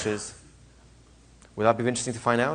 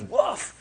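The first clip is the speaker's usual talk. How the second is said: higher barked woof; mock dog bark; 10 semitones higher than usual. Teacher acting out a dog barking at Johnny. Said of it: "big dog goes".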